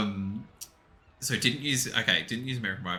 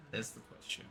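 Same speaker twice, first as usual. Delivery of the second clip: breathy whisper, no voice at all; 17 dB quieter, faster than usual. Shown as a demonstration. Whispering the famous line to the chat as a joke. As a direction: whisper the line as a dramatic aside.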